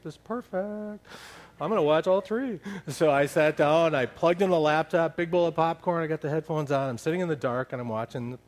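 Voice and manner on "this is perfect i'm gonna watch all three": gleeful anticipation. Retold or imitated. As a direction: savour a secret little victory. Acting out his delight at free evening.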